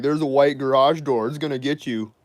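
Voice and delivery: high, loud sing-song taunt; mocking grandma. Grandma repeating the taunt, high mocking voice. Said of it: "She's like".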